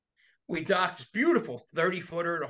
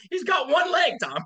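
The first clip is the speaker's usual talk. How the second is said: much higher and louder incredulous cry; exasperated protest; 11 semitones higher, 6 dB louder than usual. Retold or imitated. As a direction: blurt it out in mock disbelief.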